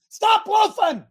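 high loud shout; coach yell. Retold or imitated. Coach shouting at loafing players, loud. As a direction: holler at the loafers. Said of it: "I see people loafing".

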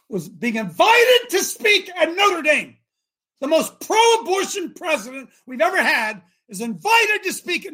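high, loud preacher's outrage; indignant outburst. Real, not acted. Preacher recounting Obama's invitation to Notre Dame, loudly indignant.

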